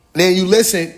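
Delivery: normal talking voice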